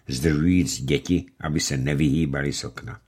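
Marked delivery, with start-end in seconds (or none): none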